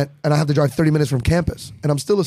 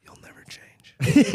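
whispered, slow and high mock bouncer; bouncer's look; 12 semitones higher, much slower than usual. Comic telling his friends what bouncers' eyes say, slow mock whisper. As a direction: breathe it out like a knowing bouncer.

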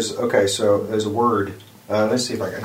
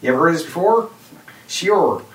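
higher, louder East Coast accent attempt; East Coast accent try; 8 semitones higher, 3 dB louder than usual. Shown as a demonstration. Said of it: "I'm trying to do it".

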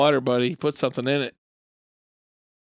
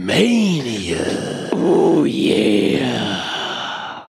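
wrestler voice; 4 semitones lower, much slower than usual. Acted. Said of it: "That's my favorite one he does".

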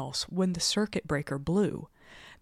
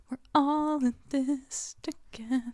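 breathy high whispered quote; mock whisper; 9 semitones higher than usual. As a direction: whisper it like a shared secret, half joking. Quoting investigators' team spirit.

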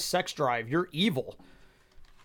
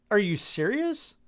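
ordinary talk

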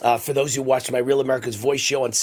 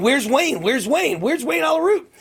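much higher pitched searching call; imitating Trump calling out; 12 semitones higher than usual. Host mimics the president hunting for him mid-speech, insistent calling. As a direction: call out for a missing person, retold. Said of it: "And he's going".

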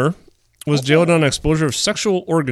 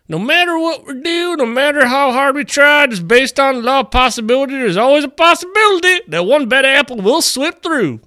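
mock sheriff voice; 9 semitones higher than usual. Quoting the sheriff.

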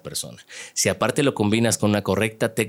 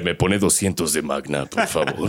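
deep villain tone at gas station; 4 semitones lower than usual. Retold or imitated. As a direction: order gas like a suave villain. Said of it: "voz de villano y habla".

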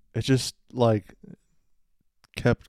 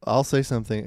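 normal talking voice

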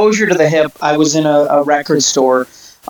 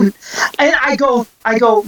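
normal talking voice

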